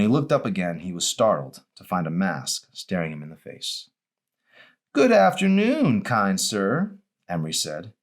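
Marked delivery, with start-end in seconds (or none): 4.9-7.0 much higher, slow and teasing greeting; a girl voice hamming a formal hello; playful masked girl. Acted. Narrator voices Emery.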